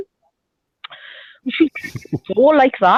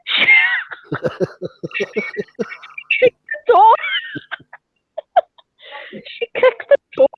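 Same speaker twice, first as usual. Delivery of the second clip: much higher, breaking up with laughter; can't get it out for laughing; 13 semitones higher than usual. Real, not acted. Friend telling the door story, laughing helplessly.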